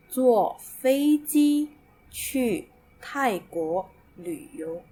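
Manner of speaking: slow, careful Mandarin reading; teacher modelling a Mandarin sentence slowly; slow model sentence. Shown as a demonstration. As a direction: read each word slowly for learners. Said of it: "so for example".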